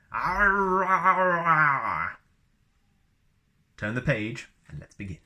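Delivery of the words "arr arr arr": loud, high mock Wookiee roar; Wookiee growl. Shown as a demonstration. Demonstrating Wookiee phrase.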